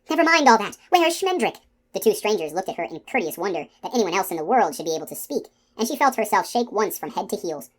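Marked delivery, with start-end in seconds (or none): 0.1-1.6 high, loud, urgent interruption; raised voice, cutting them off; crying out. A narrator's reading. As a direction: break in with an urgent cry.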